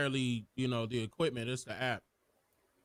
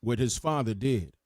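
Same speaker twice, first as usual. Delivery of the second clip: narrator voice; 7 dB louder than usual. Shown as a demonstration. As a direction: read it like a documentary narrator. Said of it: "do the narrator voice".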